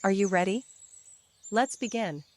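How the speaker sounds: ordinary delivery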